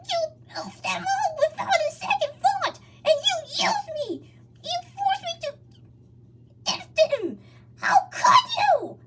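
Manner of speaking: high, loud tearful scream, slowing down; crying scream. A narrator's reading. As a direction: scream through tears in betrayal. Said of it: "Hog screamed".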